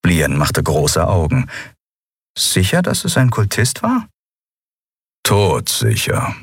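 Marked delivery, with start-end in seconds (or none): 2.4-4.1 lightly voiced young woman, higher and mocking; Lien, amused and scoffing. Lien mocks the crew's fear of the cult, amused and higher. 5.2-6.3 low, slow grumble; grumbled. Acted. Keru answers Lien with a low, slow grumble. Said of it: "brummte Keru".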